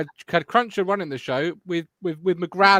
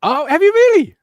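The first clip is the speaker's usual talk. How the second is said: hammed-up gasp of surprise, much higher and louder; mock amazement; 11 dB louder than usual. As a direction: squeal it in a put-on, over-the-top astonished voice. Mock amazement at the carvery delivery.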